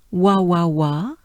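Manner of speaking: normal talking voice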